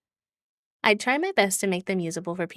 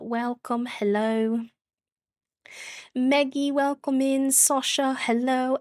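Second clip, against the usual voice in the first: higher-pitched, put-on British greetings; bad British accent; 4 semitones higher than usual. Streamer welcoming raiders by name, bad British accent. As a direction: greet each one in an amateur British accent. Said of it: "the bad British accent".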